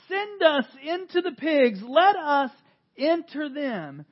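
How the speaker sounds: begging demons. Acted. Preacher reading the demons begging Jesus for the pigs, loud. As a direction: plead hard and slow. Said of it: "begged them earnestly".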